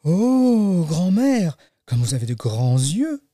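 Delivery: high drawn-out "oh", settling afterwards; childlike wonder; amazed little girl. Acted. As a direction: marvel like a storybook child. Girl marvels at disguised wolf.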